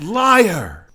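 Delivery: high, loud accusing yell; shouted heckle. Acted. Someone in the crowd heckles the speech, high shout. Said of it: "someone shouts".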